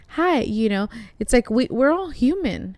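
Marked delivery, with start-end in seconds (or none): 0.1-0.4 put-on bright "Hi", a little higher; the forced smile of someone always on; fake cheery hi. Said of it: "turn it on all the time and be like".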